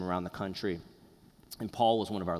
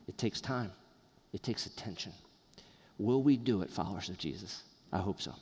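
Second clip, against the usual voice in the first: ordinary delivery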